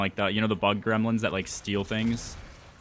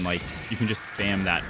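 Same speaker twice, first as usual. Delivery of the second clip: usual speaking voice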